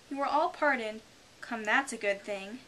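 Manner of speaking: ordinary delivery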